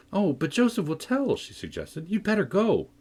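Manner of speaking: put-on high, anxious young Catherine; young girl voice. Cathy voiced by narrator.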